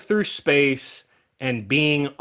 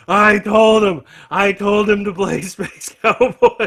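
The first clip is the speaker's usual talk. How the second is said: high, loud mock-winded holler; gassed, breathless; 6 semitones higher, 6 dB louder than usual. Friend running back to his seat with beer, mock-breathless shout. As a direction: imitate an excited friend who just ran up. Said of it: "just gassed, can't, can't breathe, can't talk".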